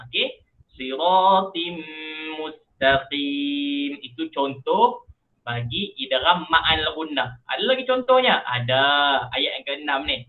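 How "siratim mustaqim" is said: slow chant, long hummed m closing SIRATIM; merged into mim. Shown as a demonstration. Teacher repeats the example for students, merged slow chant. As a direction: stretch SIRATIM into MUSTAQIM on a nasal hum. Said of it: "masukkan dalam huruf mim".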